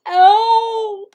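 high, loud howled plea. A narrator's reading. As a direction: howl for help like a coyote. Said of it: "he howled".

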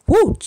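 sudden high loud woo to bleep a word; high yelp covering a curse. Real, not acted. Mother censors a curse while recalling her abuse, yelping woo. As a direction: swallow the swear with a yelp. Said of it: "gotta block out the curse word".

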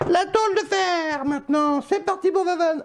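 hyped announcer. Acted. Announcing the next target.

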